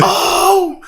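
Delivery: sudden high startled yelp. Retold or imitated. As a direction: jump out of your skin. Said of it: "I'm like".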